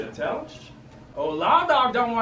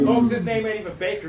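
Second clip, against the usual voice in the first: usual speaking voice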